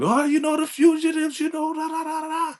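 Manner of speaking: way up high, nervous and pleading; mock Elder Mother. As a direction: squeak it out like a messenger scared of blame. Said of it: "But she's like".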